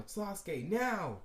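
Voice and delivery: high-pitched call building to a loud shout; yelled call. Acted. Naruto cues Sasuke for the fireball attack, yelling. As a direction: call out, then belt the last word. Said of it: "Naruto would then yell".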